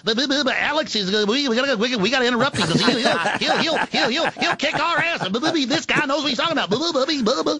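high, fast, flustered pleading; frantic high voice. Pretend. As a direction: panicky high-pitched babble.

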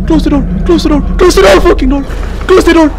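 frantic close the door; panicked repeated command. Real, not acted. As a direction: urge the door shut in a panic.

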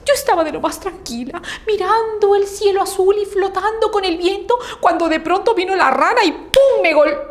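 high, protesting cloud voice; the cloud's voice. Acted. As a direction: defend yourself in a high aggrieved voice. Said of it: "la nube le dijo".

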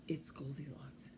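hushed unvoiced reveal; whisper. Teacher whispering to children who is upstairs, whisper. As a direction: breathe it like a secret to children.

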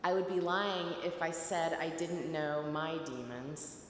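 ordinary talk

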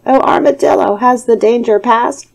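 high anxious call. A narrator's reading. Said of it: "the tiger called out".

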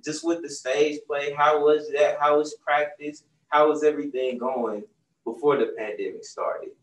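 usual speaking voice

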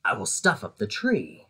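low, sly Grinch voice with a grin; lightly voiced sly Grinch; grinning Grinch. A narrator's reading. Grinch grinning to himself over his plan, low and sly. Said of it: "grinned the Grinch".

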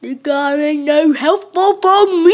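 put-on slow, loud grumpy old man; gruff old man voice. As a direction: growl it like a cranky old miner. Playing the grumpy old miner.

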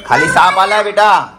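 father rushing son because of a snake; urgent alarm. Real, not acted.

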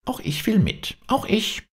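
very high, eager little hare voice; happy calling out. A narrator's reading. Hare begging to come along, happily calling out. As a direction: pipe up gladly in a high voice. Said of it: "rief froh der Hase".

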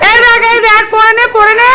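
high, pleading coax. Real, not acted. Coaxing son into a sweater.

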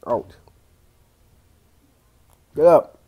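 ordinary talk, nothing marked

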